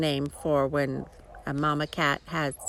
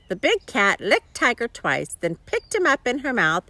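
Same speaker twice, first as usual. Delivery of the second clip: usual speaking voice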